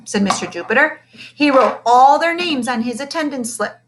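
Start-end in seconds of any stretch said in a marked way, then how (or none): none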